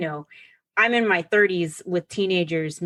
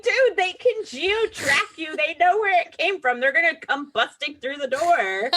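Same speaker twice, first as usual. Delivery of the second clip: panicked warning; 8 semitones higher than usual. Retold or imitated. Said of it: "we were like".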